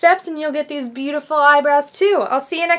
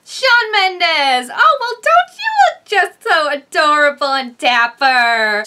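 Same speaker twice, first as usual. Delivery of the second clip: over-the-top gushing; 3 semitones higher, 5 dB louder, slower than usual. Acted. Reviewer fawns over [name]'s outfit, hammed-up gushing. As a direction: coo loudly over him, hammed-up.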